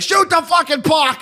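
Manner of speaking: imitating a fan screaming at the Leafs from the seats; fan yelling. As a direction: bellow it at the ice like an angry fan. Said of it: "him yelling and screaming".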